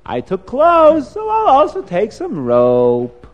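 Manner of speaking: slow, drawling dismissive tempter; tempting inner voice. Acted. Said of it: "And the other voice says".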